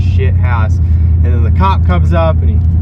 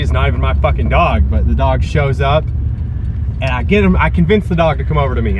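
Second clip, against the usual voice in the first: ordinary talk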